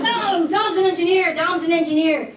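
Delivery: put-on loud, high, insistent drunken chorus; drunk friends. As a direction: vouch for a friend like tipsy bar pals. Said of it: "so we're kinda drunk".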